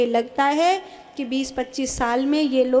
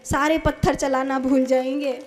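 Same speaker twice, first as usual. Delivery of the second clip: ordinary talk, nothing marked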